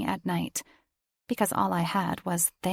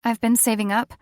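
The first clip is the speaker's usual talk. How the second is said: higher, louder, defensive; nervous daughter; 4 semitones higher, 5 dB louder than usual. A narrator's reading. Daughter pleads to angry father, nervous and high. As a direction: hurry to explain.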